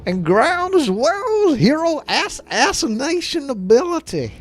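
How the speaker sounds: over-the-top Texas twang; mock Texan accent. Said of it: "Do that accent you like so much, my redneck brethren in Texas".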